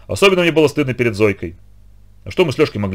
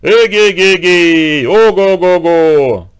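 slow drawn-out halloo from far off, louder; distant long call; 6 semitones higher, 6 dB louder, much slower than usual. Acted. Vladimir calling the children from the distant woods, drawn-out halloo. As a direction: stretch each syllable as if calling across the forest. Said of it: "далёкий-далёкий крик".

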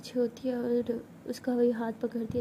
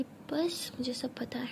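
slow, soft, secretive whisper; knowing whisper; 6 dB quieter, slower than usual. Acted. Meera to her girlfriend, teasing whisper. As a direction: breathe it slowly like a secret.

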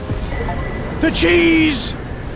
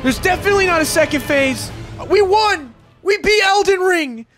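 high excited shouting, celebrating; victory cheering; 6 semitones higher than usual. Real, not acted. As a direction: cheer the win at full voice. Just beat Elden Ring.

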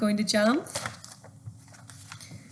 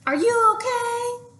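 much higher, louder call up the ladder; 13 semitones higher, 4 dB louder than usual. Acted. Dad calling up to his son on the ladder. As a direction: call up to him. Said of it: "called his dad".

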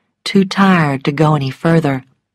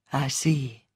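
low-key, much softer reply; quietly; 3 semitones lower, 10 dB quieter than usual. A narrator's reading. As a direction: answer very softly after the outburst. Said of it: "he said quietly".

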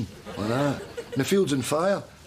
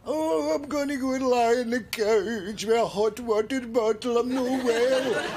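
mock sickly whine, dragging out the last words; mocking his sick act; 10 semitones higher, slower than usual. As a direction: parody a man pretending to be ill. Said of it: "Giving it all that".

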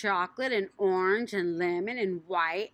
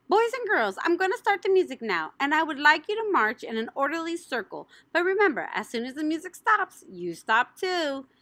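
announcer lady; 8 semitones higher than usual. Acted. Cake lady announcing the rules to the children. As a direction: call the rules to the whole room.

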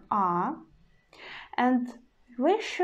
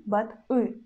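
normal talking voice

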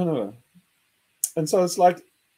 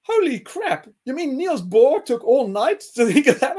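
high, loud holy crap; incredulous disbelief; 7 semitones higher, 6 dB louder than usual. Retold or imitated. Telling friends his amazed reaction, incredulous. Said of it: "I was like".

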